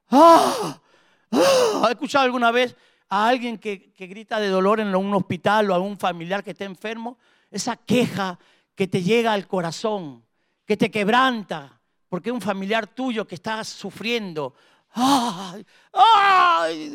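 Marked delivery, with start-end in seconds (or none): 0.1-1.8 sharp high cry of pain, rising; imitated pain cry. Preacher acting out the wounded traveler, imitated pain scream. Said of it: "gritaba de dolor". 14.9-16.9 imitated wail of pain. Preacher voicing a dying relative, imitated pain wail. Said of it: "Y grita".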